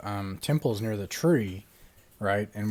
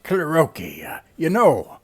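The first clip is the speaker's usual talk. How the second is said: chummy; 6 semitones higher, 8 dB louder, slower than usual. Acted. Buttering up a friend.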